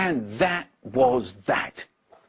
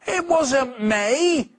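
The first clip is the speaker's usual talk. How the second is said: put-on much higher, slow drawn-out protest in a child's voice; cried out; 10 semitones higher, much slower than usual. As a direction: insist to the teacher it wasn't him. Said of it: "cried Nate".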